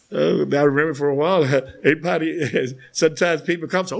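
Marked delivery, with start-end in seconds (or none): none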